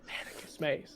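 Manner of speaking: hushed echo. Acted. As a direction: repeat the name in a hushed whisper. Player repeats the whispered name.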